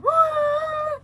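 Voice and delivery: high, squealing mock yelp; mock startled yelp. Mocking the gunman's startled cry, a high yelp. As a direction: squeal like a scared driver. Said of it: "And he went".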